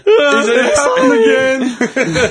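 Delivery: high, slow laugh-through; giggling through the words. Real, not acted. Laughing at the barber school joke.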